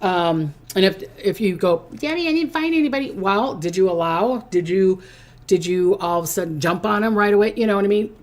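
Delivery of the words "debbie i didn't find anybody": whiny viewer. Pretend.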